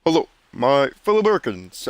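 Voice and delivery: a hint of a presidential address, barely changed from own voice; half-hearted presidential greeting; Obama impression. Host opens with a bad Obama impression, lightly voiced. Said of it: "horrible impression of Obama".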